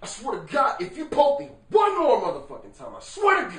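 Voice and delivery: high, loud acted outburst; sibling finally snaps, yelling furious threat; furious shout. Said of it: "Finally, you turn, you say".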